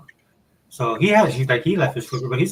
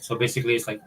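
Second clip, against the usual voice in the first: ordinary talk, nothing marked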